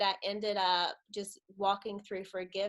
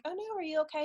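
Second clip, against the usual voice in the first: worried concern; 9 semitones higher, much faster than usual. Retold or imitated. Acted concerned reply to dad.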